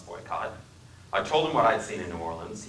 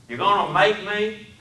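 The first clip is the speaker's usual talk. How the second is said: put-on challenging Southern accent; 11 semitones higher, 7 dB louder than usual. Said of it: "His speech was the deepest South".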